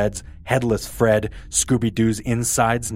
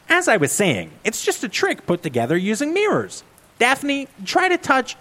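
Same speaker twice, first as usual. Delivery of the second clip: put-on higher, know-it-all girl; Velma voice; 14 semitones higher than usual. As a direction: insist you solved it.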